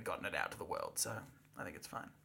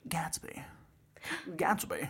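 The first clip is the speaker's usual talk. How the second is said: Gatsby voice; 6 dB quieter, much slower than usual. Shown as a demonstration. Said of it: "What does Gatsby's voice sound like?".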